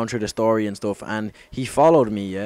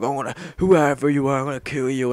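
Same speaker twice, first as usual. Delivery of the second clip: higher-pitched mock-furious threat; super angry; 4 semitones higher, 5 dB louder than usual. Drunk man ranting on Facebook at his unknown attacker, mock super angry. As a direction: retell an angry online threat, pitch pushed up. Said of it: "just being super angry".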